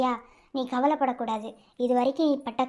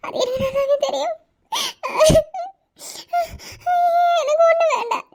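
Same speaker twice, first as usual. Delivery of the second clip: laughing through tears; 5 dB louder than usual. Acted. Dying woman laughs through tears.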